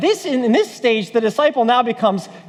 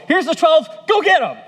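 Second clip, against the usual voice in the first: higher-pitched hearty sendoff; raised, punchy send-off; mock sendoff; 7 semitones higher, 3 dB louder than usual. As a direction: send them off like a coach. Acting out a sendoff of twelve.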